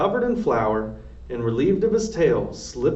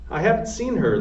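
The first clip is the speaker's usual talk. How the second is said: raised voice, pitched up; shouting; 7 semitones higher than usual. A narrator's reading. Louise shouting that she hasn't seen the girl. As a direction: Louise yelling her denial. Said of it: "Luis was shouting".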